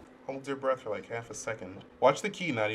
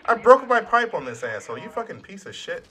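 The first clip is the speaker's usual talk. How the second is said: angry outburst; 9 semitones higher, 8 dB louder than usual. Real, not acted. Pipe broke mid-fight.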